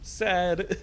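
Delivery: high, loud whiny "Sad."; annoying voice. Pretend. Gamer mocking how others say sad, annoying voice. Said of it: "They'll also say sad in a really annoying voice".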